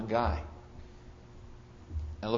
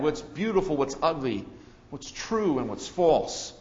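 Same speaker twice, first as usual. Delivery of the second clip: ordinary talk, nothing marked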